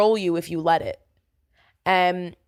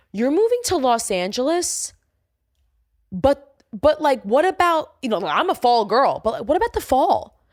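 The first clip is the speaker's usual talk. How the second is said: mocking doubters; 6 semitones higher than usual. Mimicking people doubting her move to LA, higher mock worry. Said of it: "I had people tell me".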